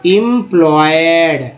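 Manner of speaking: एम्प्लॉयड slowly stretched as im-ploy-ed; syllable-by-syllable pronunciation. Shown as a demonstration. Teacher building a word from parts, एम्प्लॉयड sounded out slowly. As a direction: draw out each part of एम्प्लॉयड. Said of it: "ईएम को एम बोलते हैं".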